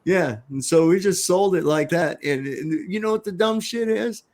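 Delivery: normal talking voice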